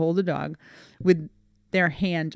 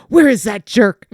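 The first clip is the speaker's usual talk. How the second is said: loud mock-angry dog voice; indignant dog; 4 semitones higher, 9 dB louder than usual. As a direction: play a dog storming in after an enemy. Voices dog hunting for her.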